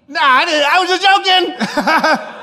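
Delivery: sheepish laughing retreat to the manager; laughing backpedal. Retold or imitated. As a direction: laugh it off to save face. Backpedaling after outburst.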